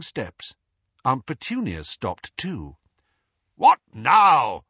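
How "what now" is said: loud, sharp bark, much louder. A narrator's reading. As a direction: snap it out impatiently from the doorway. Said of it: "barked Uncle Vernon".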